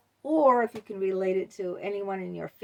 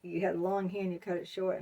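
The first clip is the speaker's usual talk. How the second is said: ordinary talk, nothing marked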